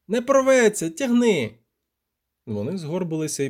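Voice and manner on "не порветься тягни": high, louder command; forceful high-pitched urging; urgent shouting. A narrator's reading. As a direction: shout it back mid-struggle. Urgent retort while hauling line.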